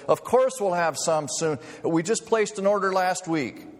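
high, urgent horrified manager. Retold or imitated. Store manager rushing to reassure a customer, mildly hammed-up horror. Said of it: "Horrified, the manager came running over".

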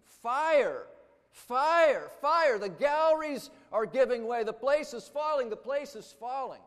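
high, very loud alarm shout; retold panicked yell. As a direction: imitate someone yelling a warning across a crowded hall. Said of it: "someone yelled".

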